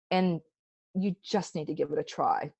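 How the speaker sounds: ordinary delivery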